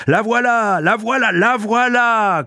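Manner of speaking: high, excited proclamation; crying out. A narrator's reading. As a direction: call it out high, stretching the last one. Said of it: "clama Tchoukov".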